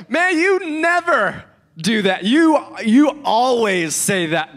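high, stretched-out "never" and "always"; exaggerated absolutes. Spouse flinging absolutes in a fight, drawing them out. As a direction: drag out "never" and "always", loud and accusing. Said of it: "I am terrible at this".

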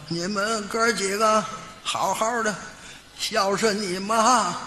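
slow, halting parting advice; lightly acted failing father; dying father's last words. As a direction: eke out a dying man's final wish.

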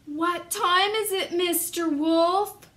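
high, loud, drawn-out call; loud game call. Acted. The children call out loudly to the wolf across the room.

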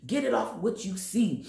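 ordinary talk

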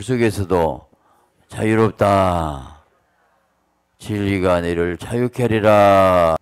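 loud, slow proclaiming; preaching at full force. Acted. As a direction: proclaim freedom to the congregation. Preaching climax. (힘찬 설교 선포)

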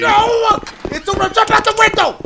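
high, loud desperate shout; panicked yelling. Real, not acted. Player cornered by the enemy, panicked yelling. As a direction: yell as if all is lost. Panics as game threat nears.

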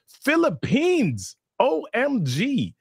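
thrilled host drawing out the news; excited. Real, not acted. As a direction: savour the word like a thrilled host. Reading out an international viewer.

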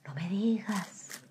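soft, drawn-out coyness; hushed flirty reply; mock coy. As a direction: stretch it out softly, playing flattered. Plays herself answering flirtation.

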